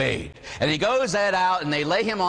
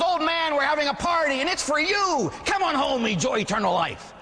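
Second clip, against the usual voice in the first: very high-pitched, festive calling out; jubilant party host; 12 semitones higher than usual. Acted. Beermeister God inviting the old man to his party, jubilant. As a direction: call him in to the celebration, high and jolly.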